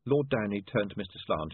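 ordinary delivery

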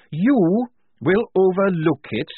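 loud, slow, high outrage; snapping. A narrator's reading. Tonka repeating Blouse's words slowly, furious. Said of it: "Polly could see Tonka snap".

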